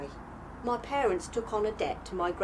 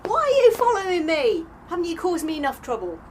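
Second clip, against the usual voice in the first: louder, higher, cross demand; exasperated shout; 5 semitones higher, 8 dB louder than usual. Acted. Priya rounding on the friend who follows her, exasperated shout. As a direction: snap back at them in irritation.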